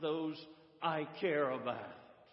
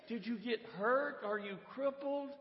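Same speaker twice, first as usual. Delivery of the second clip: high, rising questions of concern; acted concern, voice pitched well up; concerned questioner; 8 semitones higher than usual. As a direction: probe anxiously for what went wrong. Preacher questioning dog in story.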